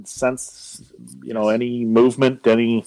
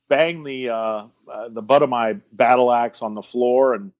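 ordinary talk, nothing marked

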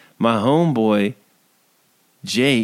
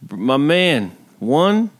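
usual speaking voice

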